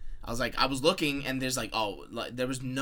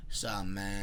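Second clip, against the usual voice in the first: low, lazy rapper impression; 5 semitones lower, 5 dB quieter than usual. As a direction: talk like he's on lean. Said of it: "I'm just gonna pretend how he ta".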